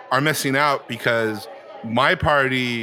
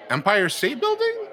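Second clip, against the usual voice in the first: higher, questioning BUILDING; unsure question; 9 semitones higher than usual. Acted. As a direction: guess it as a rising question. Improvised liar put on the spot.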